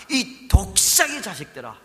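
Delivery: escalating condemnation, pitch climbing; angry rebuke. Acted. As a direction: thunder it at the hypocrites. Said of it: "아마 이렇게 이야기하시는 겁니다".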